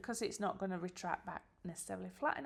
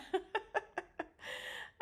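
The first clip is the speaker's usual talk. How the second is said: short airy embarrassed laugh; a quick self-conscious chuckle; breathy laugh; 12 semitones higher than usual. Real, not acted. Embarrassed at own face on screen.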